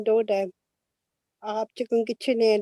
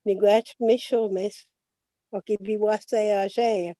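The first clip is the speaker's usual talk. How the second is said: measured Ojibwe blessing, unhurried; Ojibwe prayer. Acted.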